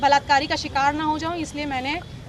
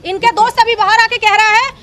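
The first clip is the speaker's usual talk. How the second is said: outraged; 5 semitones higher, 8 dB louder than usual. Real, not acted.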